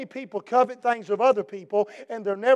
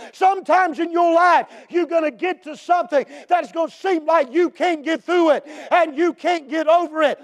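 preaching at full force; 5 semitones higher, 3 dB louder than usual. Acted. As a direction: preach it out over the congregation. Preaching builds to shouts.

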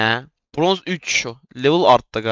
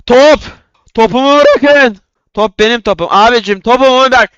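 high, loud outraged cries over the ball; outraged shouting; 9 semitones higher, 7 dB louder than usual. Real, not acted. Gamer shouting at players who took his ball, outraged. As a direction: demand the ball back at full voice.

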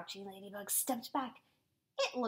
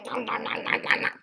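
loud, playful "nom nom" gobbling; cartoon munching; munching noises; 8 dB louder than usual. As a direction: gobble it up noisily, like a hungry bug. Imitates the ladybug munching aphids.

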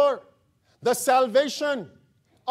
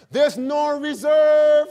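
loud, higher, slow shout of the point; preaching shout; 5 semitones higher, 6 dB louder, slower than usual. Real, not acted. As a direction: bellow it from the pulpit.

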